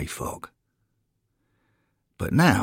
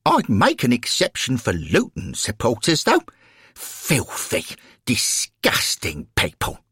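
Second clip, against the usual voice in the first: put-on scornful elderly lady; 6 semitones higher than usual. Florence scorns the rival supporters.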